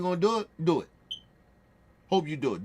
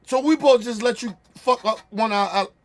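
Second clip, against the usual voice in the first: angry yelling; 5 semitones higher, 5 dB louder than usual. Real, not acted. Streamer yelling at Russia over its allies, angry shout. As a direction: bellow it, furious at the country.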